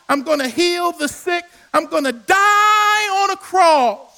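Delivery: preaching build. Acted. Boy Jesus telling the elders his plans, rising to a cry. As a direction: climb each line until the cross rings out.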